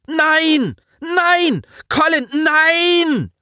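very high, drawn-out screams; desperate yelling. Acted. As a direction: scream it in horror. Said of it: "brüllte Simon".

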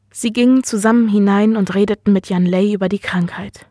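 ordinary delivery

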